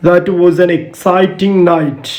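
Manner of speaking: very slow, careful example reading; slow dictation. Shown as a demonstration. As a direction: spell out each sentence slowly for the class. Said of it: "Next one". (പതുക്കെ ഉച്ചരിച്ചു വായിക്കുന്നു)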